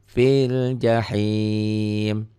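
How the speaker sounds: slow tajwid chant; Quran recitation. Shown as a demonstration. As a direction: draw the words out like a reciter. (lantunan tartil pelan)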